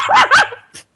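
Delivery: high shrieking laugh; high burst of laughter. Real, not acted. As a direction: break into a squealing laugh. Narrator breaks up laughing.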